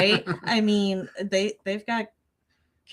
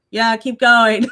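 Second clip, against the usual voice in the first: loud call to keep going; 5 semitones higher, 9 dB louder, slower than usual. Retold or imitated. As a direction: shout it up from the command center. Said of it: "they're like".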